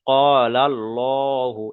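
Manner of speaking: "কলাল্লাহু" slowly, with a long heavy "lo"; thick Allah. Shown as a demonstration. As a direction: recite "Qaalal-lohu" drawn out and rounded. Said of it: "পড়ার সময় পুর করে পড়তে হবে". ("কলাল্লাহু" পুর করে, টেনে "কলাল্লোহু")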